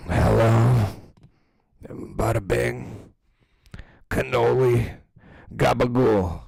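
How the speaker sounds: loud mock Italian-American mobster accent; Manalfi's Italian-American accent. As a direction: ham up a New Jersey Italian accent, slow on bada bing. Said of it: "you could tell when Manalfi just wakes up".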